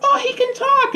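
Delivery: very high, squealed exclamation; imitating a kid's amazed squeak; excited little sister. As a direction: squeal it like a thrilled child. Acts out sister's excited discovery.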